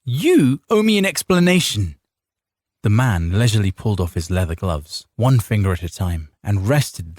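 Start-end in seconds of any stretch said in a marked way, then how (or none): 0.1-2.0 indignant wife. A narrator's reading. Wife confronts mounted noble.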